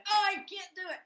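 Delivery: high, loud whiny defeat; imitating a stressed-out worrier, high and loud; mock despair. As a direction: wail it like you've given up. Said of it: "When you're up here going".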